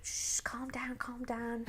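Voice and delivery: imitating a hushed, breathy shush to calm her; mock whisper of the husband trying to quiet her; hushing whisper. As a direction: retell him hushing her in a half-whisper. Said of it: "he was like".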